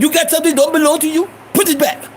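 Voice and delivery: high, accusing storekeeper voice; mad Iranian shopkeeper. Retold or imitated. Iranian shopkeeper confronts a boy with stolen gum, imitated high and mad. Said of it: "He getting mad".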